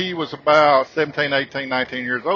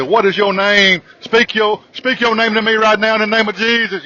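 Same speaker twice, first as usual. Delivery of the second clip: louder, commanding exorcist voice; mock exorcist command; 5 semitones higher, 5 dB louder than usual. Man orders the ghost to speak its name, like an exorcist. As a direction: cast out a demon, half-joking.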